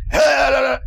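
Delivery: high, loud preacher's yell; imitated shouting. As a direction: imitate a preacher yelling at full tilt. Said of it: "this guy is shouting".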